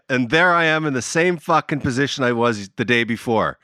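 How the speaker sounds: ordinary talk, nothing marked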